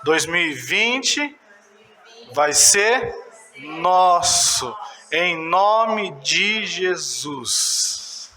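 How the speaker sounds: slow phrases with pauses for repetition; leading congregational declaration. Shown as a demonstration. Preacher has church repeat after him, slow declaration. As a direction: lead the crowd phrase by phrase, drawn out. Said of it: "Fala comigo".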